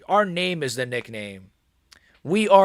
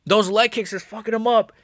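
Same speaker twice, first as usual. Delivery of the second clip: ordinary talk, nothing marked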